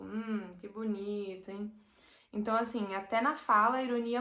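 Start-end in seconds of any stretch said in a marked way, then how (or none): 0.0-1.7 ironic. Shown as a demonstration. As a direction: praise that clearly means the opposite, held down and cool. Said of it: "Você faria tipo". (tom irônico, baixo e seco)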